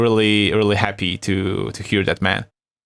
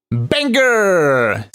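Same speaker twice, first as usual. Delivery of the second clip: excited hype; 6 semitones higher than usual. Real, not acted. Hyping his beat.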